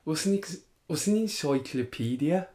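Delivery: puzzled Brummie footballer impression; Grealish. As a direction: stumble into the question in Birmingham accent.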